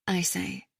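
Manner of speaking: plain reading voice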